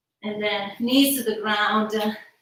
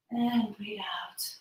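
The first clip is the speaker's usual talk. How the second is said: soft whispered exhale; slow deep breaths; 7 dB quieter, slower than usual. Shown as a demonstration. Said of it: "Breathe in".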